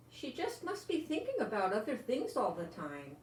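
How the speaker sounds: plain reading voice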